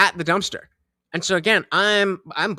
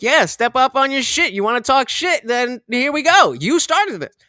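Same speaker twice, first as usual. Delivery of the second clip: retold defiance; 6 semitones higher, 4 dB louder than usual. Acted quarrel, answering the challenge.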